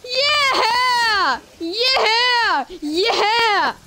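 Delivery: high, jubilant shouts of victory; excited cheering. Real, not acted. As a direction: cheer like you finally won. Finally wins, celebrates loudly.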